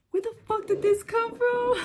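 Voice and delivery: loud, high-pitched yell of disbelief; shocked outburst. Retold or imitated. Woman retells hearing of his baby, shocked high yell. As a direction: re-enact stunned outrage, voice shooting way up.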